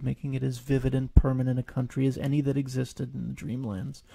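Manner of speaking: ordinary delivery, nothing marked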